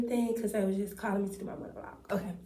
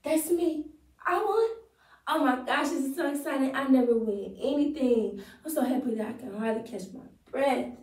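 higher, brighter overjoyed winner; monologue take; 4 semitones higher than usual. Acted. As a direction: gush with higher joy on That's me and I won. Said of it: "All right, let's go".

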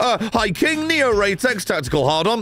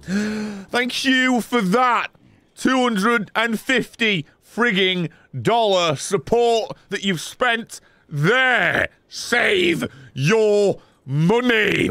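slow, higher, drawn-out amazed thanks; gasping slow gratitude; 4 semitones higher, much slower than usual. Real, not acted. Streamer thanking a viewer for a big donation, slow and amazed. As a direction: stretch it out like you can't believe it.

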